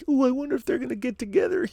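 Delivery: very high, quick squeal with a giggle; mock giddy fangirl voice, tittering at the end; gushing shipper fan. Host mocking fans who wonder who'll get together, gushing falsetto.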